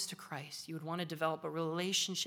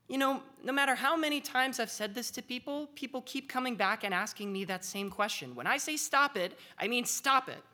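exasperated lecturing; 6 semitones higher, 4 dB louder than usual. Retold or imitated. Comedian explains his order.

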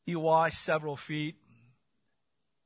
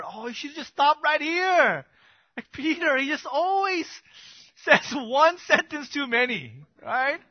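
exasperated; 9 semitones higher, 4 dB louder than usual. Real, not acted. Exasperated aside about Peter.

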